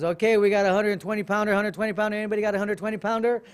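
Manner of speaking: loud, fast auctioneer call. Retold or imitated. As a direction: rattle off the weights like an auction. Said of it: "it was like an auctioneer would come out".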